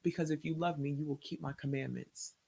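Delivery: low, soft, steady pronouncement; voice of God. Retold or imitated. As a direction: lay down the rule quietly. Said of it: "He said".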